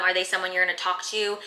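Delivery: normal talking voice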